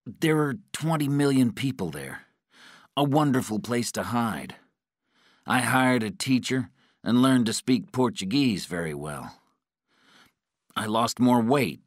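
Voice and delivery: captive's voice. A narrator's reading. Patrick answers his interrogator, anxious captive's voice.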